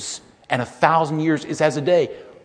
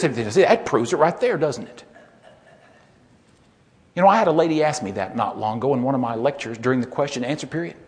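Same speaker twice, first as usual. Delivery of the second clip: ordinary talk, nothing marked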